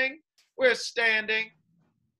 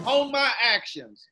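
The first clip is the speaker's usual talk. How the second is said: call-out line; 4 semitones higher, 5 dB louder than usual. Shown as a demonstration. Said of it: "continue to repeat after me".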